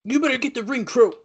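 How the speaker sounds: high, barking wrestler demands; angry wrestler orders. Acted. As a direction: boss the crew around. Voicing Jarrett ordering crew.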